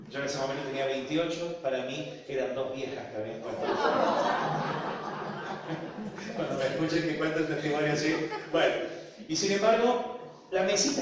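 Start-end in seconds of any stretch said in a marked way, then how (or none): 3.7-6.3 breathy burst of real laughter. Real, not acted. Audience hears him laugh at his own joke.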